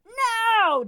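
very loud, high roar. A narrator's reading. Jordan refusing the stunned deckhand, roaring. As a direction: blast the word out. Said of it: "Jordan rolled".

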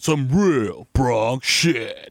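"real" drawn out, every word stretched; very slow emphasis. Shown as a demonstration. Agreeing with his cohost, slow emphatic. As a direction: lean on each word slowly. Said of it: "repeats slowly".

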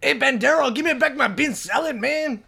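high, loud, hammed-up Mexican accent. Shown as a demonstration. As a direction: demand it in a cartoon Mexican accent. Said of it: "Do a Mexican accent".

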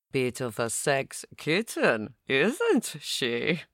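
lightly voiced extravagant English accent; plummy English accent, lightly done. A narrator's reading. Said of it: "retorted Martin in his extravagant English accent".

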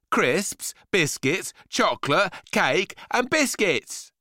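put-on high, insistent boy's list; stroppy boy. A narrator's reading. Boy lists his five a day, stroppy and high. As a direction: count them off in a high kid voice.

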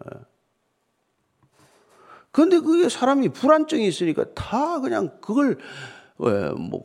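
ordinary talk

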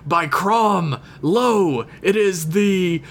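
booming slow epic announcer voice; epic barbarian narrator. Pretend. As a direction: proclaim it like a Conan intro. Epic Conan-style announcement.

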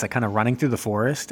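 usual speaking voice

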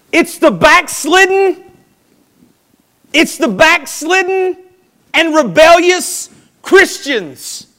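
high, loud, drawn-out preaching shout; forceful denunciation. Real, not acted. Preacher calling out backslidden Christians, forceful. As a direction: hammer it slowly at full voice.

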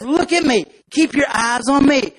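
a touch of a coaxing guide; guiding ant. Acted. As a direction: lead the lost ant along. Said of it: "the real nerdy looking ant".

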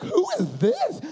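put-on high-pitched, puzzled girl's voice; put-on high female voice; girl on phone. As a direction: ask it high, as the girl on the line. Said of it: "She's like".

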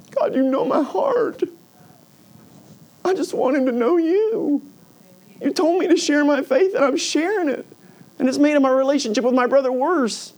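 high, strained, imitated crying plea; tearful prayer. Brother's witness praying on the couch, imitated tearful plea. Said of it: "I sat on the couch crying, going".

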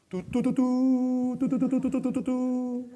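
high, loud "tu-tu-tu" fanfare; mock trumpet fanfare. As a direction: toot a mock herald's trumpet call. Mimics a fanfare for expected thanks. (fanfarria burlona de trompeta)

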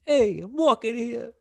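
put-on high, drawn-out New York accent. Host trading regional accents with co-host, mock New York accent.

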